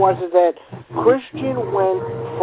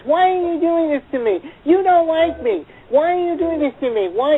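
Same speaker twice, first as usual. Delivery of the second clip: high, swooping mimicked whine; mocking childish whine, pitched way up; whiny imitation; 10 semitones higher than usual. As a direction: whine it like a three-year-old. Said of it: "I'm doing it in the exact voice".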